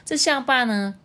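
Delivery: ordinary delivery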